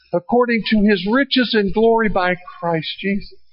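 ordinary talk, nothing marked

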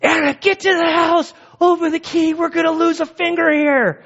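high, loud barked orders; hammed-up emergency commander; Navy seal crisis mode. Dad retelling ordering Anna around in mock crisis mode. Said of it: "I go into Navy seal crisis mode".

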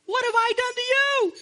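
very high, squeaky donkey voice; the preacher voices Balaam's donkey, high and squeaky; talking donkey. Retold or imitated. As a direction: pitch it way up like a pleading donkey. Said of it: "And the donkey even says".

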